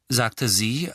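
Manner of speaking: ordinary delivery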